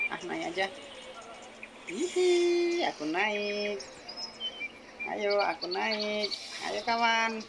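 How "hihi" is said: giggle. Real, not acted. Giggles before climbing aboard.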